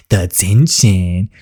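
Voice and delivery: "the tension" drawn out slowly, savoring each word. Shown as a demonstration. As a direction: stretch "the tension" out slowly for drama.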